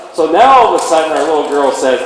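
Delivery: usual speaking voice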